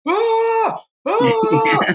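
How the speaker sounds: high piercing cry of pain; retold scream, very high; mock scream. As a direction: screech it like a man getting clawed. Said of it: "I just hear this guy start screaming".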